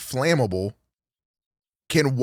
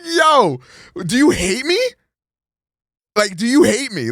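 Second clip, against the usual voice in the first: pleading yell; 11 semitones higher, 5 dB louder than usual. Retold or imitated. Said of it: "I'm like".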